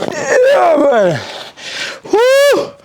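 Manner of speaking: high, strained exertion yell; straining. Real, not acted. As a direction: push out through the burn. Straining through burning reps.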